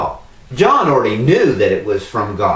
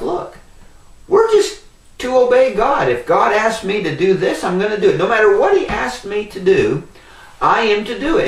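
raised pitch, firmly insistent; emphatic resolve; 8 semitones higher than usual. Retold or imitated. Preacher voicing his own thoughts in Bible study, emphatic resolve.

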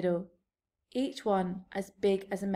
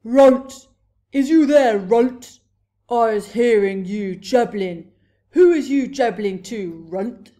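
booming giant's roar, much louder than narration; thunderous shout; 2 semitones higher, 14 dB louder than usual. A narrator's reading. Giant outside the cave hailing Runt, thunderous shout. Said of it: "a voice like thunder shouted".

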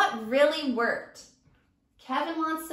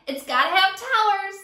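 higher, louder demand; eager; 6 semitones higher, 6 dB louder than usual. Acted. Teacher as Sammy, eagerly planning the fort. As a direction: insist with excitement.

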